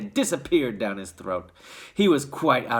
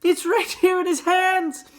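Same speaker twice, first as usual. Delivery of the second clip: excited shouting; 5 dB louder than usual. A narrator's reading. Shopkeeper shouting joyfully.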